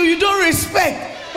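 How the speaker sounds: high, loud, slow shout; angry accusation. Retold or imitated. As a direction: fling the accusation like an angry husband. Repeats husband's angry line.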